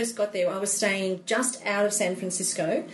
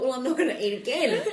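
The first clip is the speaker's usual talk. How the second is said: laughing; 11 semitones higher, faster than usual. Real, not acted. Laughing at her own overeating.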